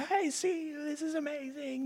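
high, soft, tearful wonder; healed blind woman. Retold or imitated. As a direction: imitate the woman seeing for the first time, awed and quiet. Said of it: "and is crying".

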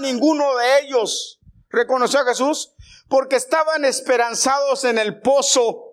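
preacher urging the congregation, loud and fervent; full-force preaching. Acted. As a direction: drive the point home to the congregation.

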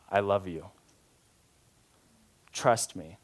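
low, soft and unhurried; tender, with space between each phrase; loving reassurance. Acted. Dad at eye level with his son, loving. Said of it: "get something across to me in love".

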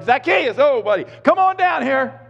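high, loud friendly call up into a tree; beaming shout of welcome. Retold or imitated. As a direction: call him down like an old buddy. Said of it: "Jesus comes along and he's like".

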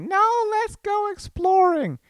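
exaggerated high, drawn-out wife impression; mock wife voice. Husband mimicking his wife's excitement, mock high voice. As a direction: send her up in a loud, slow falsetto. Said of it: "I am making fun of you".

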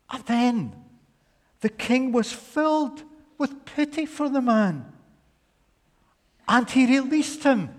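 high, loud dramatic preaching; storytelling at full force. Acted. Preacher acting out the parable, in a loud high telling. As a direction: tell it big and breathless, building.

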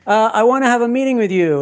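high-pitched mock Italian accent; Davide's Italian accent. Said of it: "this is not my accent".

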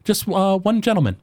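a small forest gnome greeting a customer; high-pitched gnome shopkeeper; gnome baker. Acted. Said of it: "She goes".

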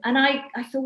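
usual speaking voice

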